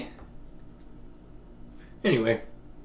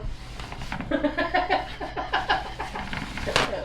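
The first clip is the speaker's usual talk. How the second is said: high breathy giggle; 13 semitones higher, 9 dB quieter than usual. Real, not acted.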